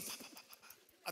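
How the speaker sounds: very soft, voiceless popping heartbeat; imitated heartbeat. Shown as a demonstration. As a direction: tap out a racing heart with hushed pa-pa-pa. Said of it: "Y el corazón así".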